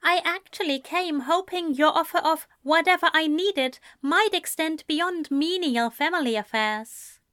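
put-on poised young man insisting politely; lightly voiced Ezra. Ezra asks a favour.

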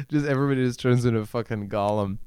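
usual speaking voice

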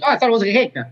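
a hint of a naive kid; childhood self. Remembering thinking cake was cacca, lightly voiced child. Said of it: "I was like".

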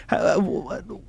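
usual speaking voice

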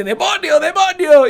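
high, loud religious fanatic shout; fanatic scream. Retold or imitated. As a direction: cry out like a zealot.

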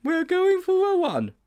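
high-pitched panicky schoolboy; blurting Sponge. Acted. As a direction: blurt out an improvised excuse. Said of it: "surprising himself almost as much as Mildew".

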